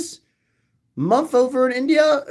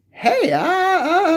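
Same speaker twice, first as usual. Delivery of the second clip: ordinary talk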